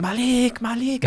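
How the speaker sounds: put-on kids calling from cells. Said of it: "they're banging".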